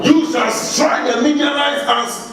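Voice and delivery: high, building toward a shout; rising preaching. Acted. Pastor stirring the congregation to victory, building to a shout. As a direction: drive it up like a rallying cry.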